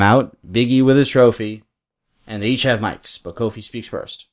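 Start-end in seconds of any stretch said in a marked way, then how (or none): none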